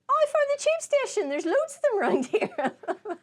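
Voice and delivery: high, loud chirpy glee; thrilled at finding the tube; high excited squeal; mock delight. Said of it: "And then".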